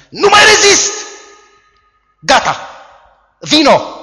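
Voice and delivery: very high, loud outburst; desperate cry. Acted. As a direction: lose all control and cry out. Man overcome by lust cries out.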